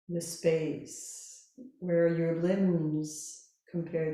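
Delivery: slow, calm Yoga Nidra guidance with long pauses; guided meditation. Real, not acted. Yoga teacher settling listeners lying down, calm guided meditation. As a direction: guide them gently into rest, unhurried.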